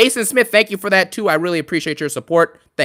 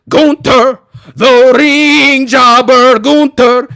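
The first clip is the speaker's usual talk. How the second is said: loud, higher, slow drawn-out announcer call; booming mock intro for Gunther; mock ring announcer; 5 semitones higher, 7 dB louder, much slower than usual. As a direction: stretch every word like a ring introduction.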